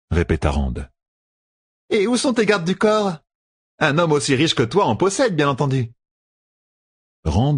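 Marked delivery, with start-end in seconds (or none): 1.9-5.9 marchande aiguë et mielleuse; a sly merchant woman, pitched high; voix de marchande. Acted. Renel insiste sur les gardes. (voix aiguë de marchande rusée)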